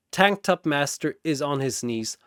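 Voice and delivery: usual speaking voice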